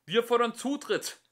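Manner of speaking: high, loud demanding yell; young philosopher yelling his demand; shouting. A narrator's reading. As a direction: storm in yelling. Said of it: "schrie der jüngste".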